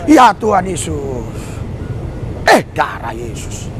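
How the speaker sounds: imitating someone full of Jesus; devout believer. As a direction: drop low and slow like a pious reflex. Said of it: "Begitu dia".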